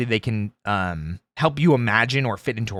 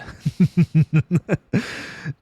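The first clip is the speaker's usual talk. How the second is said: villain laugh; 4 semitones higher than usual. Retold or imitated. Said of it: "people want me to be the more".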